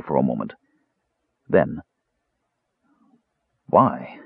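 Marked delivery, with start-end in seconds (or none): none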